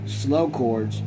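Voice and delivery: usual speaking voice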